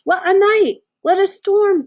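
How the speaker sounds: high, loud, chirpy bird voice; twittering wren. Acted. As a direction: chirp it like an excited little bird. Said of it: "twitters wren".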